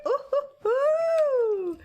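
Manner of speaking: very high slow ooh hoo hoo; high drawn-out happy hooting; giddy squeal. Pretend. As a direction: hoot with silly delight. Giddy squeal about sleep.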